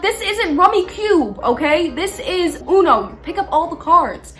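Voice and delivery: exasperated arguing. Retold or imitated. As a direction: lay down the rules indignantly. Acted quarrel over the rules.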